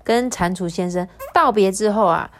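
plain reading voice

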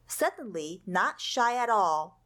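usual speaking voice

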